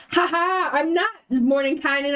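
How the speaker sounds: high, gloating trickster voice; mock villain reveal. Impostor reveals himself to Zabilna, mock villainous glee. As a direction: crow it with a stagey laugh.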